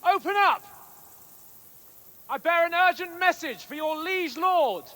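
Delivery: high, loud shouts up to the walls; calling out at the gate. Acted. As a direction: hail the guards from below the gate. Calling up to closed castle gate.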